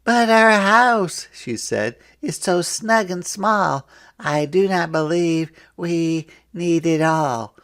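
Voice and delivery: wife's voice. A narrator's reading. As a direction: object sweetly but firmly. Missus Tim answers in story.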